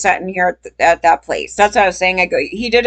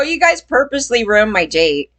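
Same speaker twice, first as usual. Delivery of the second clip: usual speaking voice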